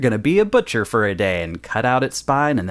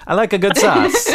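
higher, mock-fancy vague European accent; a made-up European accent that doesn't exist; vaguely European accent; 8 semitones higher, 3 dB louder than usual. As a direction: put on a made-up European accent, a connoisseur praising sauce. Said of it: "I don't know what accent that is, vaguely European".

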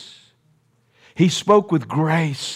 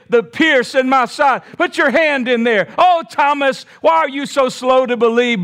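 pleading Jesus; 6 semitones higher than usual. Acted. Jesus calls Thomas.

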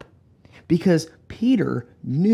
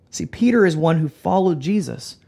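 ordinary delivery

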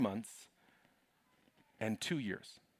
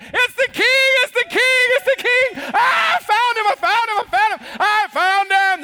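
high, loud, ecstatic screaming; the wise men ecstatic at finding the king; ecstatic shouting; 14 dB louder than usual. Acted. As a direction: scream it like you've lost your mind with joy. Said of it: "They were like".

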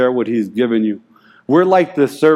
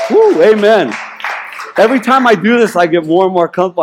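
louder and higher, a joyful whoop then rushing on; real excitement; excited; 6 semitones higher, 4 dB louder, faster than usual. Real, not acted. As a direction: burst out happy, higher and louder. Excited outburst in sermon.

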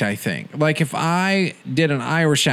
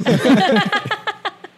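a high squealing laugh; 14 semitones higher than usual. Real, not acted. Friend laughs after imitating the song. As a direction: laugh at your own imitation. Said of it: "It was so on point".

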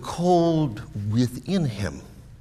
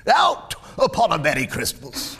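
high, loud tirade of an indignant miser; ranting Scrooge; 10 semitones higher, 6 dB louder than usual. Acted. Miser rants at his nephew about Christmas, furious. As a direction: build from loud scorn to full high-pitched outrage.

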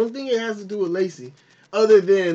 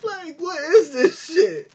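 confused viewer; 7 semitones higher, slower than usual. Retold or imitated.

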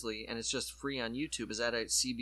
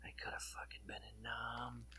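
whisper; 14 dB quieter than usual. Retold or imitated. Imagined inner voice of Troy.

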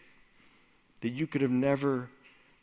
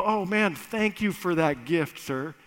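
mock gratitude; 4 semitones higher, 3 dB louder than usual. Mock grateful worker to employer.

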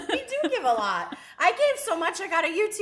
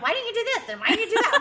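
high, nagging mock complaint; 7 semitones higher, faster than usual. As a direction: pepper him with complaints. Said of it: "or I'm like".

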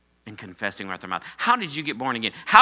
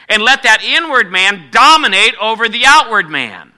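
raised, louder preacher's voice; emphatic preaching; 5 semitones higher, 6 dB louder than usual. Real, not acted. Pastor to congregation on ruling the flesh, emphatic preaching. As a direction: preach it out with punched key words.

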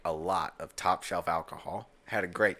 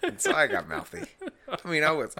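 laughing; 10 semitones higher, 6 dB louder than usual. Real, not acted. Laughing while telling drunk story.

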